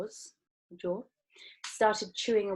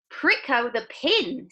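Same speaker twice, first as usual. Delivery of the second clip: gruff father; 6 semitones higher, 6 dB louder than usual. A narrator's reading. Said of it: "said one of the other fathers".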